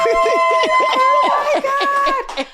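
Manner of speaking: very high, drawn-out squeal; real overjoyed scream; excited scream. Real, not acted. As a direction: shriek with excitement at a grand introduction. Guest reacts to her big introduction.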